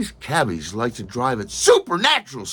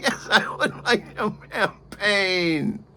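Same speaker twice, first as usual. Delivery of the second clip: laughing acceptance; 9 semitones higher, slower than usual. Retold or imitated. Delighted to be offered champagne.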